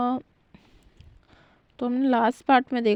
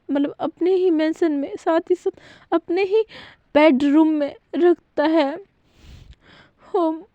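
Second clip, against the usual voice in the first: tearful complaint; 6 semitones higher than usual. Acted. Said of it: "Lucy बोलती है रोते हुए".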